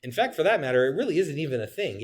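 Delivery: usual speaking voice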